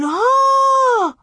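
very high, long, loud holler; yelling. A narrator's reading. Almanzo flies headlong into snow, yelling. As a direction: stretch the yell out while falling.

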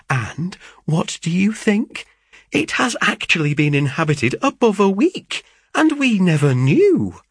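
high, breathless-with-news gossip; bursting with news. Acted. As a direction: build up to the big reveal, pitch climbing. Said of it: "I have been bursting with it".

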